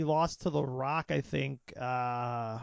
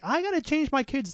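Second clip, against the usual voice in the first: mom voice; 11 semitones higher, 4 dB louder than usual. Retold or imitated. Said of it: "Imagine some mom".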